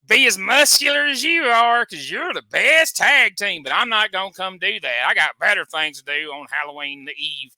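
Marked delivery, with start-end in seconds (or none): 0.0-3.6 high, loud, fawning shouts, slow; mock gushing fan. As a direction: swoon over the wrestlers like a starstruck fan. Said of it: "sit over there on the microphone all night and be".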